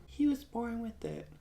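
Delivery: high, soft put-on voice; mimicked film character. Family member explaining the tattoo to Jax, high soft mimicry.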